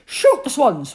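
loud command; shout. Acted. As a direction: bark the order at the guards. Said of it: "one of them shouted".

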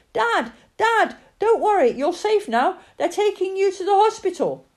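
high, loud excited calling. Acted. Son calls to his rescued father, high loud calling. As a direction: call out to your father in relief.

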